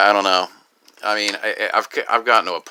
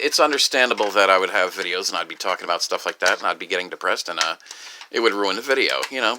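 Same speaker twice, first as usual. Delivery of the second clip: ordinary talk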